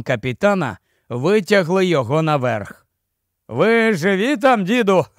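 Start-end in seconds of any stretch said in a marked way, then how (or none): 3.5-5.2 higher-pitched, laugh-tinged tease; guffawing. A narrator's reading. Fat man teases the old man, guffawing. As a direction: call it out with a laugh at the end. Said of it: "реготав один із товстунів".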